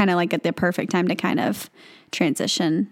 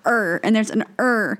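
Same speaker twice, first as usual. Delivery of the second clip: normal talking voice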